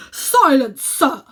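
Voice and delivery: outraged uncle demanding silence; furious command. Acted. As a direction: shut the boy up at once. Uncle silences angry boy.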